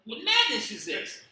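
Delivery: high, loud stunned outcry; a rider hearing his donkey speak; aghast cry. Retold or imitated. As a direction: cry out in shock at madness. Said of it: "he was like".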